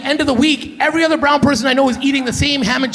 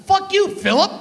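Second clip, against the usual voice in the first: higher-pitched defiant dismissal; defiant put-down; 9 semitones higher than usual. Acted. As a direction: tell him off with relish. Acted telling-off of Philip.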